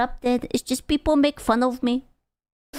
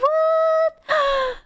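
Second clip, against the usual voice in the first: mock gasp. Dramatic gasp.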